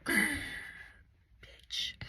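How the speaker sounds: breathy whispered swear; under-the-breath whisper; whispered reaction. Retold or imitated. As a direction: let it out as a stunned whisper. Retold stunned reaction to being exposed.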